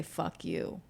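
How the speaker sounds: ordinary delivery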